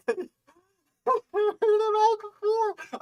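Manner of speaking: squeaky fed-up complaint; exasperated high whine. Real, not acted. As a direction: pitch shooting up in disbelief. Mocking repeated content, voice jumps.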